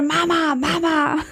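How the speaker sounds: high little-kid call, imitating daughter; mother voicing her daughter calling Mama; child calling Mama. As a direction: call out like a small child at the window. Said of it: "then I hear".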